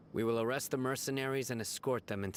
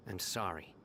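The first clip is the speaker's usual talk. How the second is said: softer, sighing apology; regretful sigh; 3 semitones lower, 6 dB quieter than usual. Acted. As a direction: sigh then a soft sorry. Refusing an ally, regretful.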